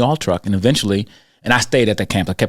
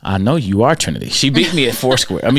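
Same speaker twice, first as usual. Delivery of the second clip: usual speaking voice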